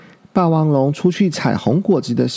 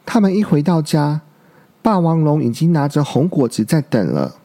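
ordinary delivery, nothing marked

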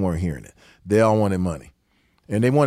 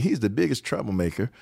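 ordinary talk, nothing marked

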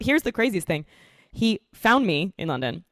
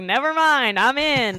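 higher, excited retold reversal; mock eager turnaround; 6 semitones higher, 4 dB louder, slower than usual. Woman retelling seeing the hot guy, mock eager turnaround. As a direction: jump in with playful instant eagerness.

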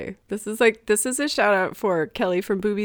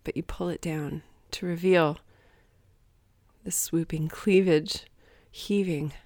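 soft, slow romance-novel read; romance narration; 3 semitones lower, 4 dB quieter than usual. Acted. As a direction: read it breathy like a bodice-ripper. Mock romance novel reading.

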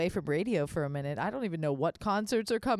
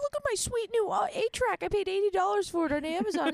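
much higher, smug hipster voice; mock hipster; 12 semitones higher than usual. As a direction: show off the eight-track like a smug hipster. Mocking hipster buying eight-track.

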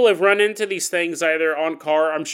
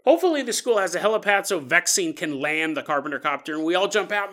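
usual speaking voice

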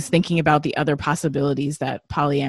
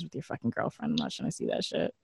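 fast, much lower, unvoiced muttered aside; 6 semitones lower, 11 dB quieter, faster than usual. Real, not acted. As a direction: grumble it under your breath. Annoyed aside about dating apps.

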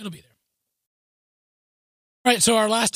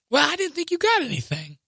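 sly teasing; 7 semitones higher than usual. Retold or imitated. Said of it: "she's just playing me".

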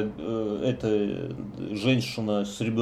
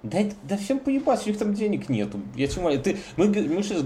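much higher, exasperated; excited outburst; 9 semitones higher, faster than usual. Real, not acted. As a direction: throw up your hands, exasperated. Indignant outburst.

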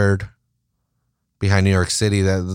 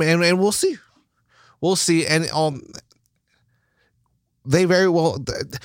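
ordinary delivery

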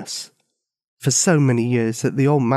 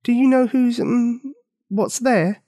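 put-on curious girl; 11 semitones higher, slower than usual. A narrator's reading. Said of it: "but then added".

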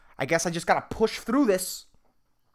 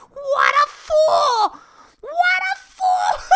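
much higher, louder, very slow mocking wail; evil voice jeering; 3 dB louder, much slower than usual. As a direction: howl with scornful glee at the fool. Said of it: "This fucking voice of evil".

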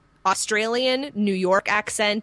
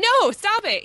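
high, louder mock protest; retelling a fan's protest to the artist, high and loud; exasperated protest; 13 semitones higher, 6 dB louder, slower than usual. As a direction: cry out in disbelief at the artist. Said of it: "And I'm going".

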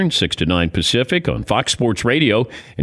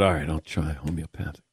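resigned; 9 semitones lower, 9 dB quieter than usual. Retold or imitated. Reluctantly agreeing to wife.

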